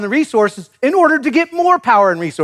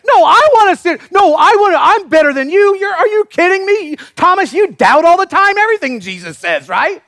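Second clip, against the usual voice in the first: put-on higher and louder bickering child voices; squabbling kids; 10 semitones higher, 5 dB louder than usual. Disciples squabbling like kids over seats, shouting. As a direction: play kids fighting over the front seat. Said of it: "That's like your kids".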